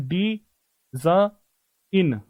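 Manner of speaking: teacher breaks डी ज इन into pieces; syllable by syllable. Shown as a demonstration. Teacher sounding out a word for students, syllable by syllable. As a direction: split it into slow separate sounds, डी, ज, इन. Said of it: "मुझे बस ये कहना है".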